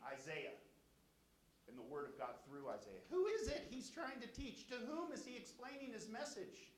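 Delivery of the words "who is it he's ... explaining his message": high, loud scoffing questions; proud scoff. Acted. Proud leaders scoffing at Isaiah's teaching, loud and high. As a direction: sneer at the prophet as beneath you. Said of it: "Do you hear their pride?".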